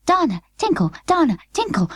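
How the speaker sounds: high, flustered doll voice; fluttering doll. Acted. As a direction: flutter it out in a tiny panic. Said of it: "fluttered Birdie".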